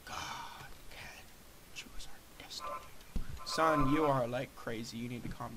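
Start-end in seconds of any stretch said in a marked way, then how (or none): 0.0-2.7 hushed whisper of a secret truth; mock solemn whisper. Son whispering to dad about God, hushed awe. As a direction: breathe it like a sacred secret.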